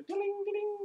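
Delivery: very high-pitched sung chime; imitated doorbell. Game master sounding the bell as the door opens, imitated chime. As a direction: mimic a tinkling bell up high.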